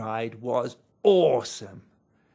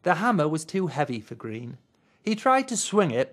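usual speaking voice

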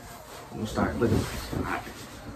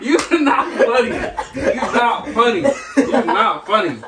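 much higher, squeaky-high repeat of an insult; laughing protest; 10 semitones higher than usual. Real, not acted. Player reacts to friend's burnt burrito jab, laughing protest.

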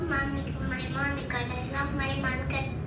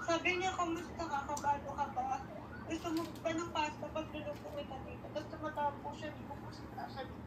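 tearful; 2 semitones lower, 12 dB quieter, much faster than usual. Real, not acted. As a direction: tell it quietly while holding back tears. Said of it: "Naiyak ka na?". (naiiyak, mahinang boses)

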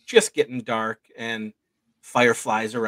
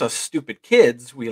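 normal talking voice

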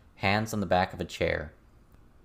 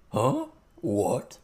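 lightly croaked, surprised goblin; a hint of froggy goblin, caught off guard; mildly startled frog-like croak; frog's croak; 5 semitones higher than usual. A narrator's reading. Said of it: "in his frog's croak of a voice".

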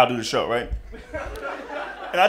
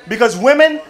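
normal talking voice

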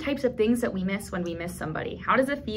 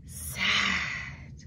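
a touch of sad, dropped and softer; sad face sign; 7 dB quieter than usual. Shown as a demonstration. Teacher demonstrating sad sign to kids, mildly sad. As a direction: show sad in the voice, lightly. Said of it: "our face shows that we're sad".

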